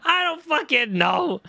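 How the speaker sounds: high, slow, exasperated yell; frustrated shout. Real, not acted. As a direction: throw up your hands, shout it out. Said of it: "frustrated at accent confusion".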